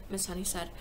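plain reading voice

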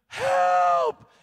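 loud mock scream for help. Man in a gorilla suit, chased by a lion, screams for help. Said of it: "he starts screaming".

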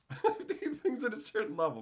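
laughing through the repeat; chuckling as he repeats himself. Real, not acted. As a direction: say it again while laughing. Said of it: "said again laughing".

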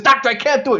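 high, loud pleading refusal; exasperated protest. Acted. Joke patient protesting to the insistent doctor, loudly exasperated. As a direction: cry it out as a desperate patient.

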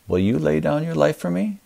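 normal talking voice

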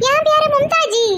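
high, loud woman's voice; Mumtaz voice. Acted. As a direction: pipe up in a high, proud voice.

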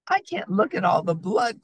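high, dramatic cry of dismay; storybook squeamish outcry. A narrator's reading. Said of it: "cried Fox".

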